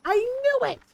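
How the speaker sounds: playful shout of I knew it; gleeful accusation. Real, not acted. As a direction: catch the deer red-handed and call it out. Spots trolling glitch deer in game.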